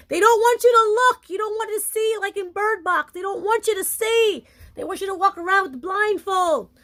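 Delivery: high-pitched emphatic rant, opening loud; impassioned warning. Real, not acted. Warning followers the Matrix wants them blind, impassioned.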